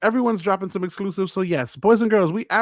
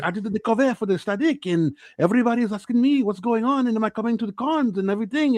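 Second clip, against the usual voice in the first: put-on weird, higher French-Brazilian accent; 3 semitones higher, faster than usual. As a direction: imitate a French-speaking Brazilian acquaintance. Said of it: "a weird French Brazilian accent".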